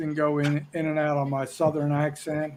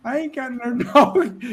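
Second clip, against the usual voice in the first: higher, louder, drawn-out mimicked drawl; Alley Cat impression; 8 semitones higher, 5 dB louder than usual. Co-panelist quotes the friend's catchphrase, playful high-pitched impression. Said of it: "as Alley Cat would say".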